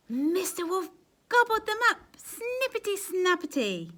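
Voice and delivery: high, loud gleeful reveal; high gleeful storyteller twist; excited climax. A narrator's reading. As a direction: burst out with the twist.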